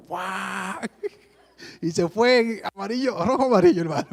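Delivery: real laughter over the words; laughing. Real, not acted. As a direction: tell it while cracking up. Laughing while retelling.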